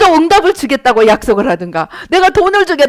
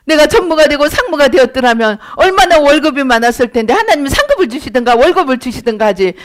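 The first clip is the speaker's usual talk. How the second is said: usual speaking voice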